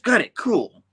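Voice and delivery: high, loud acknowledgement; hasty backing off. Retold or imitated. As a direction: imitate Spidey quickly accepting a rebuff. Spidey backs off after rebuff.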